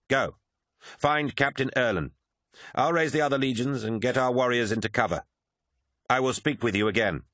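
high-pitched, firm soldier's orders; swordsman Lucius, resolute. Acted. Swordsman sends his captain to find help, resolute. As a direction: send a comrade off with lifted, decisive voice.

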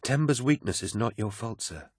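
high, soft reassurance; consoling. A narrator's reading. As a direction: comfort him gently. She consoles him.

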